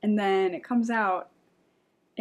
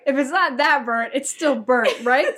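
giggling through a louder, higher protest; laughing indignation; 4 semitones higher, 3 dB louder than usual. Real, not acted. Telling a friend about the burnt pizza, laughing and indignant. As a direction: laugh while insisting on the point.

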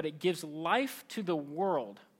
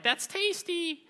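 high, drawn-out mock relish; delighted kid; 11 semitones higher, slower than usual. Preacher replays himself as a kid loving Cheetos, mock delight. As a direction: savour it slowly in a high, silly voice.

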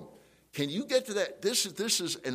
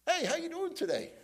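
much higher, sugary fake-friendly hello; fake cheerful greeting; 14 semitones higher than usual. Pastor mocks greeting a disliked person, falsely cheerful. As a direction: put on a bright, hollow smile in the voice. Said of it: "That's love with hypocrisy".

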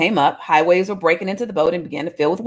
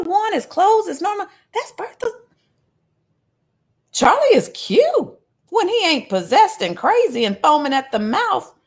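gossipy church lady; 11 semitones higher than usual. Acted. Neighbour recognising Charlie, amazed high gossip. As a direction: gasp it like a nosy neighbour.

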